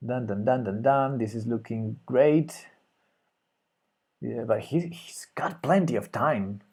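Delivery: normal talking voice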